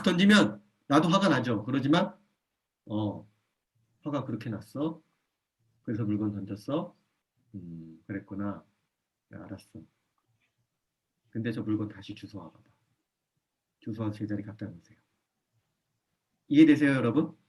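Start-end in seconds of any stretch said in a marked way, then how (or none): 2.8-6.9 calm quiet parent. Shown as a demonstration. Parent quietly telling a child to pick up what he threw. 7.6-8.6 much softer, slow, gentle but firm; calm quiet parent. Shown as a demonstration. Parent quietly telling a child to pick up what he threw. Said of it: "감정을 분리해내지 못한 거예요". 9.3-15.0 calm quiet parent. Shown as a demonstration. Parent quietly telling a child to pick up what he threw.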